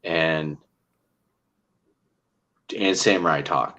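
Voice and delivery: usual speaking voice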